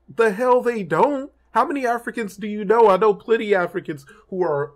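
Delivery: high-pitched, incredulous retort; indignant disbelief. Acted. Rebutting people who say Africans don't say African, indignant. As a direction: fire back in disbelief, pitch jumping up.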